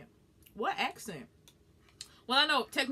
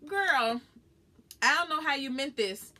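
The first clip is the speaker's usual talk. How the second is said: higher and louder, with attitude; sassy comeback; 7 semitones higher, 6 dB louder, slower than usual. Real, not acted. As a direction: set the commenter straight. Answering a teasing comment.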